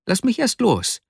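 very high-pitched plea; pleading Vasja. A narrator's reading. Pinned friend bargaining with Arkadi, high pleading.

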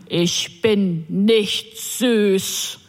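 very slow, each word of "Ich bin nicht süß" pushed out separately; words forced out one by one. Retold or imitated. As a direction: spit out every word on its own, menacingly. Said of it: "wobei er jedes Wort einzeln hervorstieß". (jedes Wort einzeln hervorgestoßen, sehr langsam)

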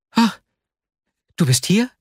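high-pitched, startled question; startled exclamation. A narrator's reading. Vasja walks in on his friend, startled exclamation. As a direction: cry out in surprise on being caught. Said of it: "rief er aus und fuhr vor Schreck zusammen".

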